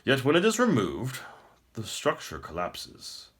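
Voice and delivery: smug emperor. Acted. The Emperor taunts the captive prince, smug and grand. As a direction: speak down to a beaten foe.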